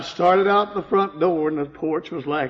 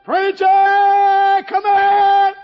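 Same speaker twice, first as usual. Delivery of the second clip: high, loud calling out; husband calling preacher for help; hollering; 9 dB louder, much slower than usual. Retold or imitated. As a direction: call him across the yard. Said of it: "And I hollered".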